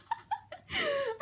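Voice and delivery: high amused laugh. Real, not acted. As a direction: laugh at the failed baking. Laughing at bad baking.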